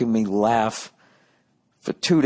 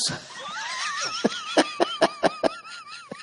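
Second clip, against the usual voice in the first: big laugh; 5 dB quieter than usual. Real, not acted. Laughing at the heckle.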